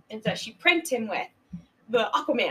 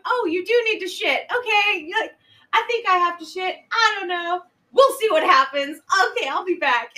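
loud chirpy chatter; 6 semitones higher, 6 dB louder than usual. Retold or imitated. Missy chattering loudly outside stall.

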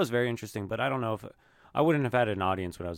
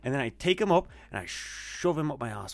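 put-on Irish accent; 4 semitones higher than usual. Friend showing off for friends, hammed-up Irish accent easing down. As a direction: start big and high in a mock Irish accent. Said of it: "I can do an Irish one pretty well".

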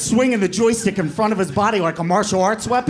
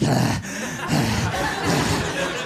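voiceless, ragged gasps; imitated gasping; 7 semitones lower, much slower than usual. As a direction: catch breath after a mock choking. Brother gasping after being choked.

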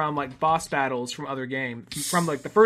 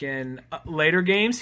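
usual speaking voice

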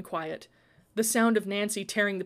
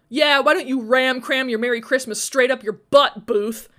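loud, higher, heated comeback; raised voice in a quarrel; angry retort; 5 semitones higher, 10 dB louder than usual. A narrator's reading. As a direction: throw the insult back loudly. Acted quarrel, insult thrown back.

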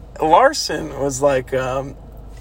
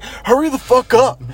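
louder, higher mock hurrying; imitated impatient urging; 8 semitones higher, 6 dB louder than usual. Friend hurrying the one running with the keys, mock impatient yell. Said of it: "And Larson's like".

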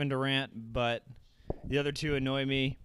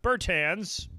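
mock play-by-play; 3 semitones higher, 3 dB louder than usual. Play-by-play call.

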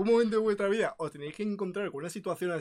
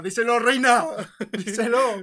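mock cheering; 2 semitones higher, 6 dB louder, slower than usual. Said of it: "Yo gritando por detrás".

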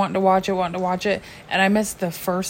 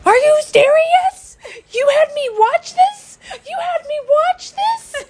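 much higher, louder and slow, a wailing tearful protest; mock crying outrage; 6 dB louder, slower than usual. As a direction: wail it at the kids through fake tears, slowly. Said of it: "and I was like".

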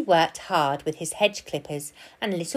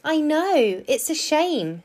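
sympathetic park keeper, pitched higher; lightly voiced park keeper; 10 semitones higher, slower than usual. A narrator's reading. Park keeper consoling his small squirrel friend, sympathetic. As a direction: commiserate gently in a higher lilt.

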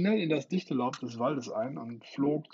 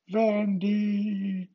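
high, loud, long cry of her name; horrified shriek; 9 semitones higher, 8 dB louder than usual. A narrator's reading. The brothers watching Wendy fall, horrified shriek. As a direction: scream in horror. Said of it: "kreischten John und Michael entsetzt".

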